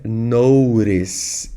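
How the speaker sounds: slow American "notice", T flapped; American accent. Shown as a demonstration. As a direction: stretch "notice" as American "no-ris". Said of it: "sotaque mais americano".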